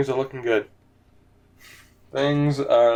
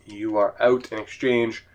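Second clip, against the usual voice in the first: ordinary talk, nothing marked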